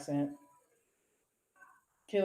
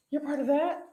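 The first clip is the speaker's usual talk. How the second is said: higher, incredulous questioning; mock disbelief; 9 semitones higher, 4 dB louder than usual. Imitating families reacting to their kid, mock disbelief. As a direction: pitch it up like an appalled onlooker. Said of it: "they're like".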